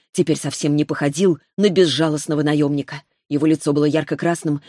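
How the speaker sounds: usual speaking voice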